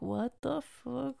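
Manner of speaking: slow and very soft, under the breath; barely voiced, slow disbelief; muttered disbelief. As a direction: draw out a hushed, appalled reaction. Retold reaction to cancelled plans.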